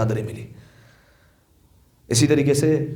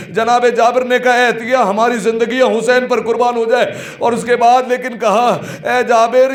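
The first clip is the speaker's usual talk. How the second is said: mourning sermon lament; 10 semitones higher, 5 dB louder than usual. Acted. Preacher telling mourners of the caravan to Karbala, high wailing lament. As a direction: preach the tragedy at full, tearful pitch to stir weeping.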